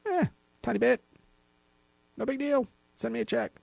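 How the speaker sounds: high, soft, offhand little voice; mock dismissive. Tax agency shrugging off a huge penalty, mock dismissive. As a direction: play it down in a small high voice.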